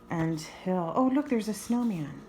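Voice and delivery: plain reading voice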